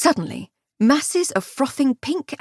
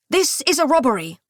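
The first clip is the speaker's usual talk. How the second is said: robber's shout; 5 semitones higher than usual. A narrator's reading. Thief announcing the robbery with a rough call. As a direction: announce the holdup. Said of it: "one shouted".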